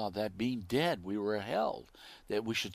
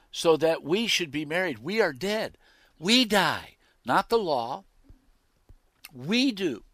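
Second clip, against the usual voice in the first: loud, raised pulpit voice; forceful preaching; 6 semitones higher, 11 dB louder than usual. Real, not acted. Preacher reading Paul to the congregation on dying, forceful preaching. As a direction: drive each short statement home loudly.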